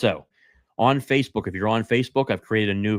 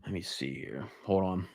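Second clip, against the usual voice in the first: quiet mutter; 11 dB quieter than usual. Real, not acted. Searching his list, muttering aside.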